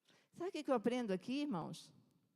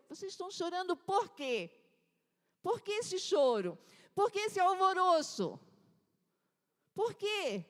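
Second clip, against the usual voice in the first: higher, louder bewildered questions; puzzled Jesus; 6 semitones higher, 4 dB louder than usual. Acted. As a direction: ask like someone who truly cannot see why they weep. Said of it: "Parecia que Jesus tinha estado no outro planeta".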